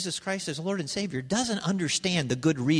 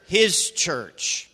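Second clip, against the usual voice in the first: loud group recitation; 2 semitones higher, 9 dB louder, much slower than usual. Shown as a demonstration. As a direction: call out every word loud and strong. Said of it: "Everybody say it together".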